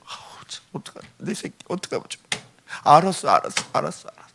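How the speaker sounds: very soft, fretting murmur; tender soothing. Acted. God fusses over His crying child, tender soothing. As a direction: hush and comfort a crying child.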